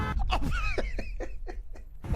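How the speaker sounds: squealing laughter that tapers off; high giggling laugh. Real, not acted. Player amused at the game, high giggly laugh. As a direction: laugh high in little bursts.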